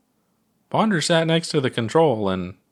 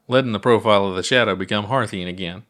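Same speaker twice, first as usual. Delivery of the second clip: ordinary delivery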